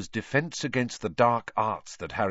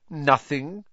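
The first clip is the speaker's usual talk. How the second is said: higher, louder, aloof dismissal; lofty; 7 semitones higher, 5 dB louder than usual. A narrator's reading. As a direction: brush him off from on high. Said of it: "in a lofty voice".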